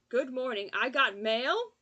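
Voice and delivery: loud, high panicked yelling. Acted. Deborah panicking into the phone, loud alarmed yell. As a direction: shout it out in alarm, drawn out. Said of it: "She sounded panicked by the idea".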